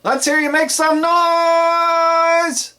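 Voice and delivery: very high, loud, long drawn-out crowd call; hype call. Shown as a demonstration. Club DJ getting the crowd to make noise, hyped. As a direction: pump up the room, stretching every word. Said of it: "sometimes you can go".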